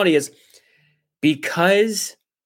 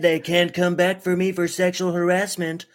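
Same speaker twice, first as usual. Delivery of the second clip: high put-on politician voice; hammed-up politician impression; Donald Trump; 4 semitones higher than usual. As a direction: parody a president's twisted logic. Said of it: "That's Donald Trump's".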